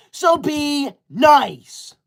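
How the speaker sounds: drawn out very slowly, pointed; slow pointed request. Real, not acted. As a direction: spell it out slowly to the chat. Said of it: "See how easy it is to be nice?".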